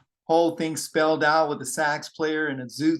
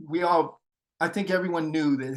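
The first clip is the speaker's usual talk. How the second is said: usual speaking voice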